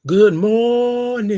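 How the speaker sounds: stretched-out welcoming greeting; drawn-out greeting. Shown as a demonstration. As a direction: stretch it out and lift it. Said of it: "I like when you say it like that".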